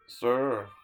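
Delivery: usual speaking voice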